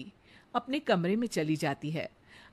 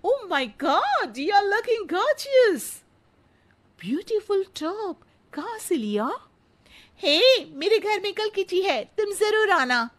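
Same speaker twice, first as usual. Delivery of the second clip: much higher, very slow, drawn-out gushing; gushing posh auntie; 11 semitones higher, 6 dB louder, slower than usual. Acted. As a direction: fawn over the new outfit. Gushing kitty-party auntie.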